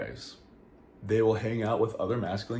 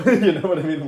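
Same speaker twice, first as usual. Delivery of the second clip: laughing; 7 semitones higher, 10 dB louder, faster than usual. Real, not acted. Laughing at own worry.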